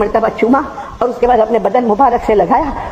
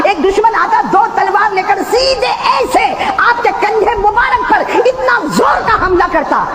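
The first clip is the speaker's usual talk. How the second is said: impassioned preaching; 13 semitones higher, slower than usual. Acted. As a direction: cry out the blow. Preaching the attack.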